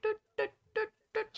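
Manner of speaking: little high toots; short, separate toy-like toots; playful paddling noises. Pretend. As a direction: imitate paddling with tiny high sounds. Imitates doggy paddling with sounds.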